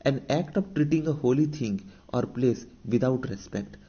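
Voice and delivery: very slow, word by word, as if for notes; dictating a definition to students; slow dictation. Shown as a demonstration. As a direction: read it out slowly so the class can write. Teacher reads English definition aloud.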